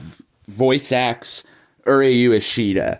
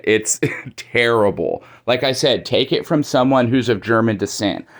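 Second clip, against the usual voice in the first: ordinary delivery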